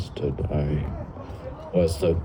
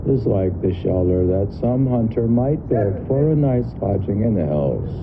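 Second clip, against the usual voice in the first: higher literary recitation; reading old text aloud; 6 semitones higher than usual. Acted. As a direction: hold the phrases out like old verse.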